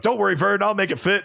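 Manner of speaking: high, chipper hillbilly reassurance; cheerful goofy Ernest impression. Ernest reassuring Vern, retold.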